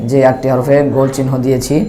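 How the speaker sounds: ordinary delivery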